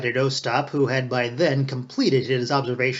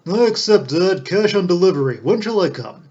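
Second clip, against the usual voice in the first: higher, louder, airy man of the world; breezy Ostap; 5 semitones higher, 6 dB louder than usual. A narrator's reading. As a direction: toss it off grandly. Ostap closing the deal.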